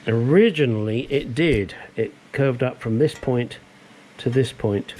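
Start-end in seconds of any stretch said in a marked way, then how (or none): none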